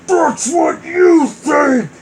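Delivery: slow, loud booming shout. Acted. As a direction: boom it out like a villain. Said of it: "A booming voice".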